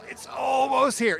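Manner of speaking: excited. Real, not acted. Hyping the upcoming release.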